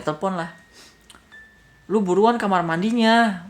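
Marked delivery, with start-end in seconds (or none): 1.9-3.5 loud, high, drawn-out demand; retold exasperated holler; impatient shout. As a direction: yell down the phone to hurry up. Retold annoyed phone call.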